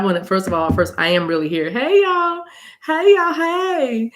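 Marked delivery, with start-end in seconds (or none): 1.7-4.1 very high, drawn-out hellos; excited greeting. Real, not acted. Greeting viewers joining the live stream, excited greeting. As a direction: call out happily, pitched way up.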